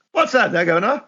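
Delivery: high, hammed-up cockney voice; mock cockney. Co-host joining the bit in a silly cockney accent. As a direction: play a cheeky London geezer.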